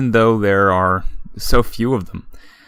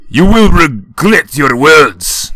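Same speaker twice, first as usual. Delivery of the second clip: loud, slow, higher slurred menace; drunken threat; 6 semitones higher, 8 dB louder, slower than usual. A narrator's reading. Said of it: "Shoji says".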